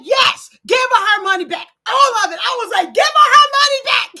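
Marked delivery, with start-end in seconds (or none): 0.0-2.4 a louder, insistent retold demand; firm demand. Retelling the woman's firm demand to the viewers. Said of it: "She said". 2.9-4.1 high, loud, fast yell; yelling at the screen. Retold or imitated. Host yelling at the TV during the show. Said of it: "I was yelling at the screen".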